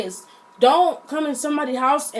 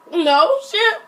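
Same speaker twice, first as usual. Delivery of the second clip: retold disbelief; 7 semitones higher than usual. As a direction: blurt it out in re-enacted astonishment. Retold reaction to shocking news.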